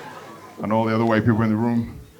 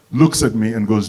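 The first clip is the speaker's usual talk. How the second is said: ordinary talk, nothing marked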